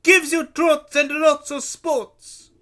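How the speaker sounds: loud, slow heavy-set vendor; thick, heavy voice. A narrator's reading. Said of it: "Luigi said in his thick, heavy voice".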